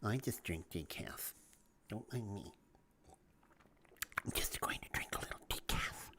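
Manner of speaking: sheepish whispered excuse; a mocking, breathy hush of a decaf drinker. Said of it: "if people sit there and they".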